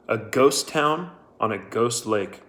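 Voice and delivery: ordinary delivery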